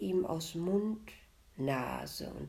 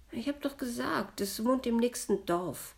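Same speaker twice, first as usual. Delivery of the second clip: higher-pitched peevish ghost; grumbling; 8 semitones higher than usual. A narrator's reading. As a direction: answer with a mild, raised-voice grumble. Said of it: "brummte das Gespenst". (leicht unwirsch)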